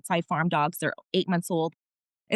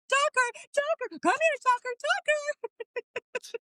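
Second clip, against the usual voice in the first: imitated high-pitched calling; calling the dog; much slower than usual. Searchers calling their missing puppy home, high imitated calls. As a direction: re-enact everyone calling for a lost dog, very high. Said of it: "he heard all of us".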